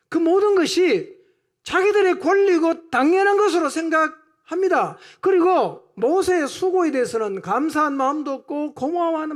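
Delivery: loud, high-pitched pulpit delivery; fired-up sermon; impassioned preaching. Real, not acted. Preaching at full force.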